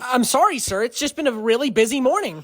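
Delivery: high, loud pleading; flustered apology. Acted. Server to screaming father, flustered apology. Worker placates screaming customer.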